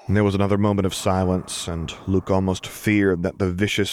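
plain reading voice

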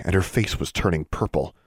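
plain reading voice

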